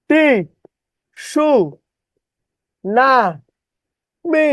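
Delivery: টি, সু, না, মি as four isolated syllables, long pauses; distorted spelling pronunciation. Shown as a demonstration. Teacher dictating the spelling trick, syllable by syllable. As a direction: sound the silent T as টি, then সু... না... মি. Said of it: "বিকৃত ভাবে উচ্চারণ করবেন".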